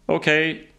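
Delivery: lightly shouted cue; calling out. A narrator's reading. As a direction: get attention over rushing wind. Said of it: "ropade Dave".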